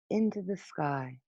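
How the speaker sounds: usual speaking voice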